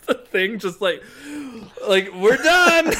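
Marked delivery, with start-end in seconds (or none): 2.1-3.0 high laughing declaration. Retold or imitated. Drunk insisting, laughing.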